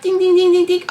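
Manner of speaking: high, rapid ding-ding-ding; imitated alarm bell. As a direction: mimic an alarm going off, high-pitched.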